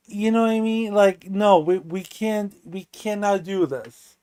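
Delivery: ordinary delivery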